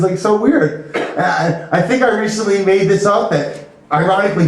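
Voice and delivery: ordinary talk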